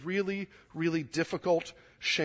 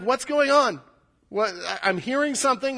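puzzled, higher-pitched asking; raised, halting voice of someone catching a sound; urgent questioning; 6 semitones higher, 4 dB louder than usual. Acted. Blind man hearing a crowd go by, urgent questioning.

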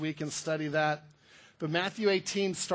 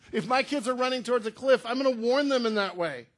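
laughing; 4 semitones higher, 4 dB louder than usual. Real, not acted.

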